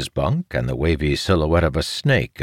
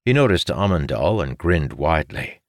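usual speaking voice

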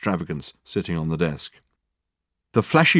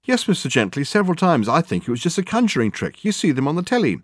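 higher-voiced secretary, humouring the boss; lightly put-on higher voice; long-suffering secretary; 8 semitones higher than usual. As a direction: placate him wearily in a high voice.

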